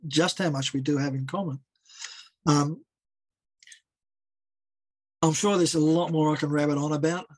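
tired voice fading; worn-out, giving-out voice. Real, not acted. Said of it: "my voice is starting to give out now".

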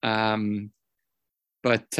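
normal talking voice

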